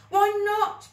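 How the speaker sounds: high, loud desperate plea; a loud pleading cry. Acted. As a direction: shout the plea. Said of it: "pleaded Billy".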